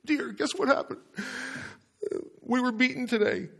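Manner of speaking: high, soft mock voice of a husband; Ahab. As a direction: squeak out a meek confession to the wife. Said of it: "Can't you just see him say".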